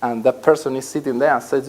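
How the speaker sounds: ordinary talk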